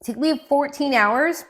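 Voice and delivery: normal talking voice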